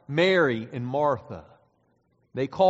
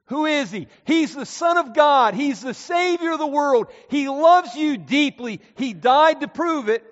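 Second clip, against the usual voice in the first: higher and much louder, ringing out; full-force preaching; 12 semitones higher, 10 dB louder than usual. Acted. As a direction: build the sermon to its climax. Climactic preaching.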